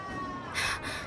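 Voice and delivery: quick startled intake of breath; sudden breathy gasp; sharp gasp. Acted. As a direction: gasp in shock at the stone. Sudden shock at blood lines.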